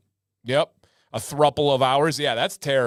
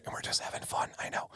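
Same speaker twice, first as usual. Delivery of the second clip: quick confidential whisper; hushed, voiceless aside; 14 dB quieter, faster than usual. Real, not acted. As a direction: drop to a whisper to reassure. Aside between mock scolding of viewers.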